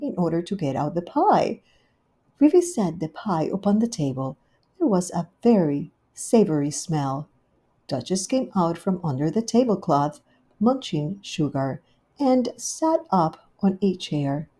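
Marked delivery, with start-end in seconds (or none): none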